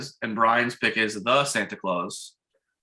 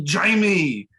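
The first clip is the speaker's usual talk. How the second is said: higher, louder call of a name; imitated movie dad; 5 semitones higher, 5 dB louder than usual. Film dad calls to his son, imitated calling out. Retelling Arnold's line from the film.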